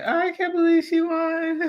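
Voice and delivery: high, put-on voice of astonished onlookers; mock disbelief. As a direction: imitate stunned onlookers in a high voice. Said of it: "they looking like".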